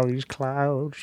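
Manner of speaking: slow put-on cartoon dad voice; Peter Griffin voice. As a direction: drawl it slowly like Peter Griffin. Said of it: "Peter, not Brian".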